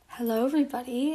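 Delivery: ordinary talk, nothing marked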